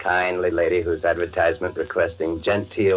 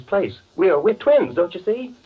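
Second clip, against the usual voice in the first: high, giddy, unhinged; spring the secret like a mad triumph; gleeful madman confiding his trick; crazed reveal; 11 semitones higher than usual. Acted.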